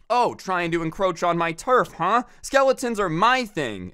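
hammed-up confrontational neighbor; territorial accusation. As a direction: call out the neighbor like a turf rival. Acts out territorial neighbor confronting another.

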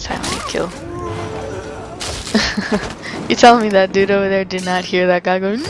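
normal talking voice

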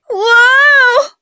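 high loud whoa of shock; sharp high startled yelp; startled cry. Acted. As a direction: jump at the sudden scare. Sudden fright, cries out.

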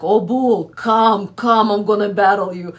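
loud, fast taunting of the bull; mock challenge. Wife taunting her angry husband, loud and fast. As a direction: goad him like a bullfighter, loud. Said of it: "They're like".

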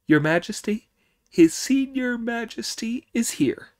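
high excited devil report; squeaky rushed announcement; little devils voice. Acted.

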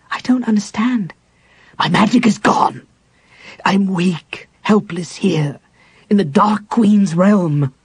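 high, loud anguished cry; Raistlin desperate. A narrator's reading. Raistlin to Chrysania in the abyss, anguished cry. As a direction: raise the voice in rising panic. Raistlin despairs, magic gone.

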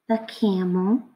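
ordinary delivery

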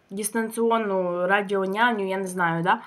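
chewing while talking; words muffled by food; mouth full of potato. Real, not acted. Seen 1: Potato spooned into mouth at 0.7, chewing until about 2.8.

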